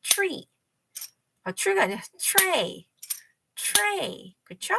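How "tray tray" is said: tray in one smooth falling beat; one syllable. Shown as a demonstration. Teacher models for children, tray as one syllable. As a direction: glide tr straight into a long ay in tray. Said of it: "요렇게 one syllable이에요".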